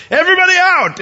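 loud, high bellow to clear a room; shouted order. Acted. Preacher playing Joseph ordering officials out, shouted. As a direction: call out a sweeping command.

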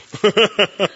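sudden loud amused laugh; burst of laughter. Real, not acted. Pastor laughing at his own dread before the congregation, amused laugh. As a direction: laugh out at the memory.

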